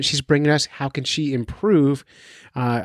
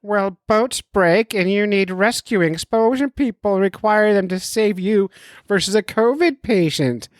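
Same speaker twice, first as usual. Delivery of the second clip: mock doctor voice; 7 semitones higher than usual. Podcaster voicing his doctor friend's message, mock doctor voice. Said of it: "I'll do the voice work for him".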